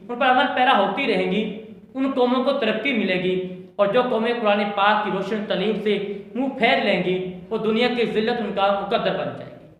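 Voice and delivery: normal talking voice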